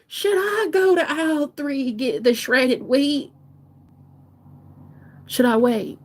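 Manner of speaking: high, slow, wavering granny voice; grandma. Acted. Grandma asking about shredded wheat, slow and high. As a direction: dither innocently as a slow granny. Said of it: "She's like".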